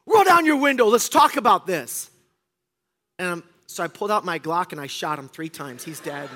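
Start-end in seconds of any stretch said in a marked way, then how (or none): 0.1-2.1 high, loud imitated bark; mock angry demand. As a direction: demand it like a hothead. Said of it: "And he goes".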